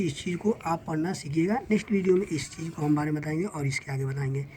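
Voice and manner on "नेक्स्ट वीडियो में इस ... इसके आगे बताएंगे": ordinary delivery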